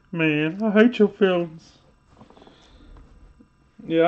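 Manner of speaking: usual speaking voice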